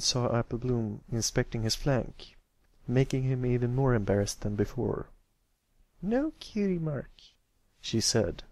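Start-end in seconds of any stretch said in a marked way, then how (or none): 6.0-7.1 high filly voice, puzzled. Acted. Apple Bloom checking his flank, puzzled. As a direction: say it like a let-down kid.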